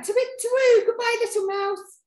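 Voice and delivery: high, loud owl's farewell; high-pitched storybook owl in alarm. Acted. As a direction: hoot a frightened goodbye, pitched right up.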